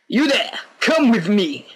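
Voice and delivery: high-pitched guard calling out; commanding guard. Acted. Guard ordering Jack to come along, commanding call. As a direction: order him over sharply.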